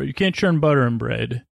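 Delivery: ordinary delivery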